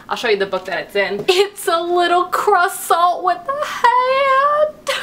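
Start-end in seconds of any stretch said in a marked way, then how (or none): 1.3-4.9 slow, higher giggly words through laughter; laughing. Real, not acted. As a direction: gush while giggling at something cute. Giggling at cute bookmark.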